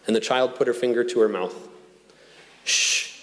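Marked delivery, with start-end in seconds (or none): 2.6-3.2 hushing shh, all breath; whispered shush. Retold or imitated. Child hushing her father with a finger to her mouth, whispered shush. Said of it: "she whispered into her father's ear".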